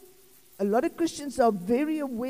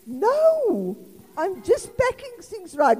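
higher, louder, innocent-sounding fib; innocent denial; 8 semitones higher, 4 dB louder, slower than usual. Retold or imitated. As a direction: play innocent when caught red-handed. Wife caught in husband's wallet.

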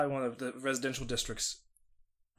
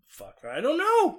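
high exasperated voice; 14 semitones higher, 6 dB louder, slower than usual. Retold or imitated. Voicing friend's exasperation.

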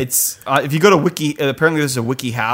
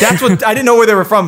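excited shout; 9 semitones higher, 6 dB louder than usual. Real, not acted. Excited outburst at team name.

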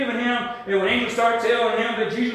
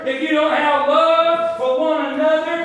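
louder, pressing sermon voice; fervent preaching; 4 semitones higher, 4 dB louder than usual. Real, not acted. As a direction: drive it home to the church. Preaching builds again.

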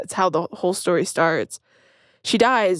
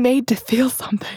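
whispered confession; 4 semitones higher than usual. Real, not acted. Confessing love of sad movies.

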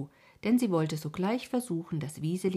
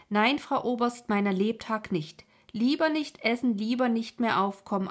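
loud, high, vehement refusal; agitated cry; 5 semitones higher, 6 dB louder than usual. A narrator's reading. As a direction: refuse loudly and emphatically. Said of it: "rief er und wurde ganz rot vor Anstrengung". (laute, heftige Weigerung)

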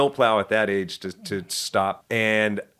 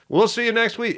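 TV host voice; 10 semitones higher than usual. Retold or imitated. Imitating the TV host.

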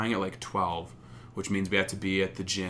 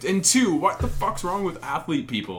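incredulous; 7 semitones higher, 5 dB louder than usual. Retold or imitated. Retold incredulous reply.

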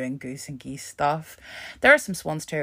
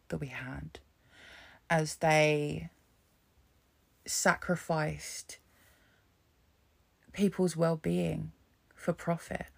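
ordinary talk, nothing marked

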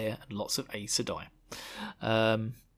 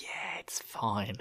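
very slow, drawn-out, softer brush-off; mock nonchalance; 3 semitones lower, 8 dB quieter, much slower than usual. Warrior reassuring worried Rand, mock nonchalance. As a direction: wave it away lazily.

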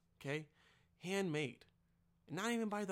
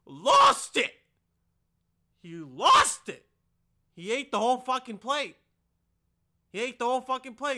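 explosive, much louder comic shout; excited yelling; 6 semitones higher, 15 dB louder than usual. Acted. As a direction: yell it like the story's big climax. Comic climax of a story.

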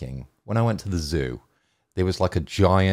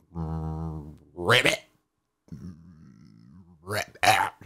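croaky ribbit from a squatting frog; a frog's ribbit, played straight; frog ribbit impression; 3 semitones lower, much slower than usual. Shown as a demonstration. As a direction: croak it out like a frog on a lily pad. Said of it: "your best impression".

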